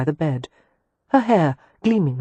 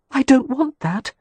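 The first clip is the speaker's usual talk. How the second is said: raised, insistent objection; protesting; 9 semitones higher than usual. A narrator's reading. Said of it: "Catherine protested".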